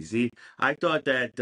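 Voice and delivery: ordinary talk, nothing marked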